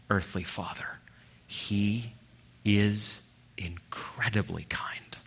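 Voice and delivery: soft, slow whisper. Real, not acted. Preacher whispering God's kindness to the congregation. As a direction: lean in and hush it.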